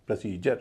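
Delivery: "प्रोसीजर" as pruh-SEE-jer; light first vowel. Shown as a demonstration. Teacher models the whole word, "प्रोसीजर" with pruh-SEE-jer. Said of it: "उसका उच्चारण होगा अ".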